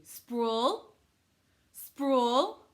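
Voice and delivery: loud, high, swooping up at the end; modelled root word. Shown as a demonstration. Teacher giving pupils the root word, loud rising call.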